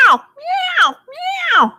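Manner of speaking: high, talky Siamese meows; chatty Siamese meow. Retold or imitated. Teacher imitating a talkative Siamese, high meows. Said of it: "They kinda go".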